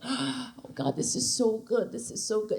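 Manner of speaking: very soft, low mock moaning; faked passion. Woman pretending to be into it while checking on him, hushed fake rapture. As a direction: pretend to be swept away, hushed. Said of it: "Be, like, really into it".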